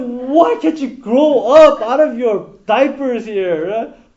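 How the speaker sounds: loud, slow exasperated scolding; furious wife. Retold or imitated. Wife scolding husband for running to mommy, loud and furious. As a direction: let her boil over at a husband who won't grow up. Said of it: "the wife was just furious".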